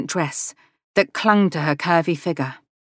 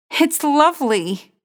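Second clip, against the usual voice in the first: much higher, slow and dreamy; a higher, slowed admiring sigh of a line; breathless delight; 9 semitones higher, slower than usual. Acted. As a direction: gush over the hat. Becca admiring hat.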